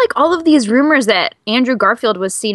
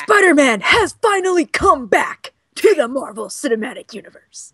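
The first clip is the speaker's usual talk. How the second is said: The Rock; 3 semitones higher than usual. Acted. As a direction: announce it like a big ring comeback. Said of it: "that was my The Rock impression".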